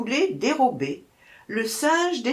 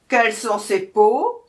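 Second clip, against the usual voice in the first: loud growling demand; growled question; 7 dB louder than usual. A narrator's reading. Lioness demanding about the cub skins, growling. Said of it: "gronda la lionne".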